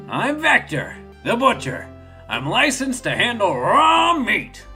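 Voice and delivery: high, emphatic boastful introduction; Vector the butcher. Acted. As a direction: proclaim it with big punchy stresses. Voices Vector's boisterous introduction.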